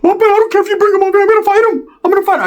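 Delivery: high, fast, loud squeaky ranting; riled-up norteño cousin. Retold or imitated. Cousin Ricky vowing to fight Sharky, high-pitched and frantic. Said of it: "going to fucking convulsion, uh, norteño mode".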